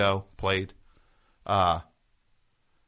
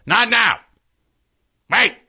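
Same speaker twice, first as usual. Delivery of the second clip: far higher, full-volume barked order; angry yell; 14 semitones higher, 13 dB louder than usual. Retold or imitated. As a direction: imitate the musician's explosive shout.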